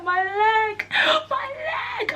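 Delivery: high, tearful imitated my leg; mock crying. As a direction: wail it like the hurt girlfriend, a bit hammed-up. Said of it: "She's laying on the floor crying".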